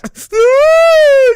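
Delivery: high, loud shrieking laugh; screaming laugh. Real, not acted. Cracking up at the highlight, loud screaming laugh.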